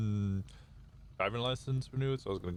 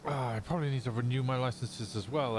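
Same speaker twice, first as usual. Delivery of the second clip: ordinary talk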